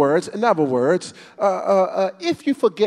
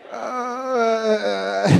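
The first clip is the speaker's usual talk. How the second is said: laughing shout; 6 semitones higher, 4 dB louder than usual. Real, not acted.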